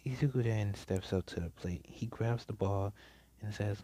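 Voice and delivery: plain reading voice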